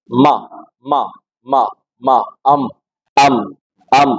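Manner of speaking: deliberate m in ما and ام, spaced for repetition; ما and ام with outer lips. Shown as a demonstration. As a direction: drill ما and ام one by one, outer lips meeting. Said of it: "جان بوجھ کر ہونٹوں کا بیرونی حصہ ملائیں". (ما اور ام، ہونٹوں کا بیرونی حصہ ملا کر)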